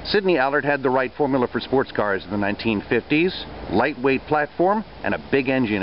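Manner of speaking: documentary voiceover. A narrator's reading. Voiceover narration of car history.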